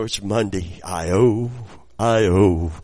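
ordinary talk, nothing marked

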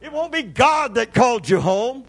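high, loud fiery preacher's cry; preaching at full force. Acted. Preacher crying out to the church, fiery and loud. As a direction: thunder it at the congregation.

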